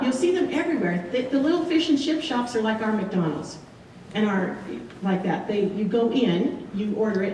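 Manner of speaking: usual speaking voice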